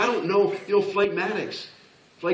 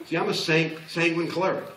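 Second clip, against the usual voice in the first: usual speaking voice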